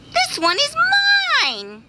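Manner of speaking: squeaky high bee voice, sharp on this; snatch it back like a spoiled bee; greedy bee shouting. Acted. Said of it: "he shouted".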